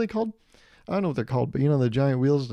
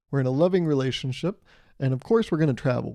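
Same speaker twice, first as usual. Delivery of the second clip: ordinary talk, nothing marked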